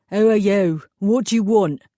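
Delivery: a burly East End mechanic taking over; East End accent. Acted. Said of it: "distinctly East End accent".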